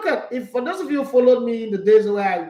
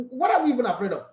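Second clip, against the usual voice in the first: normal talking voice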